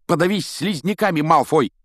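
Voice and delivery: high, loud indignant burst; angry outburst. A narrator's reading. Ron snaps back at Malfoy's taunts, exploding in anger. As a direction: explode at the bully. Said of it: "взорвался Рон".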